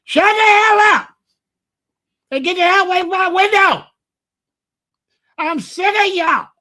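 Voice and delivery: very high, loud yell out the window; piercing angry holler. Retold or imitated. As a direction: imitate a fed-up old lady screaming at youngsters. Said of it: "she would holler out this window".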